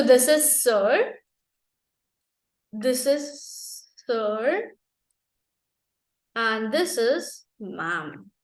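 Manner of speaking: very slow reading. Shown as a demonstration. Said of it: "slow drawn-out reading".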